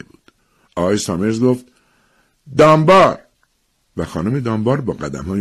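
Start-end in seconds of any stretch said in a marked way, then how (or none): 2.6-3.2 high, loud one-word summons; calling out a name. Acted. Official calling the next family, calling out. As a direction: project the surname across the crowd. Official calls names for draw.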